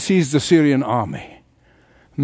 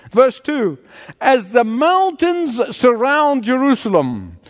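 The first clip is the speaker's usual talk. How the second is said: scripture reading; 5 semitones higher than usual. Acted. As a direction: read the psalm with weight. Reads scripture verses.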